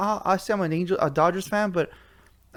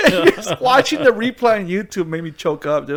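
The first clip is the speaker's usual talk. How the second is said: higher, louder, laughing through it; 6 semitones higher, 5 dB louder than usual. Real, not acted. Telling co-host the replay made him choke up, laughing. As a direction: laugh while admitting it.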